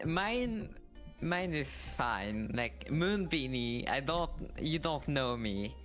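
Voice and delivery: usual speaking voice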